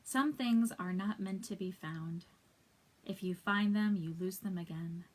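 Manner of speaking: deliberate poetry reading with spaced lines; poem recitation. Acted. Reader sharing an untitled poem with viewers, deliberate recitation. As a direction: give each line weight, pausing between them.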